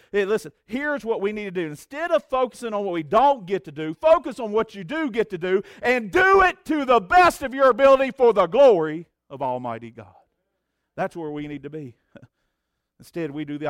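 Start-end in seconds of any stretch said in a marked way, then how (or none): 0.1-10.2 loud, high, driving exhortation; rally them to do their best; fervent preaching. Acted. Pastor urging the church to serve God, fervent.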